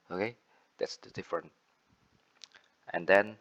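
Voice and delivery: normal talking voice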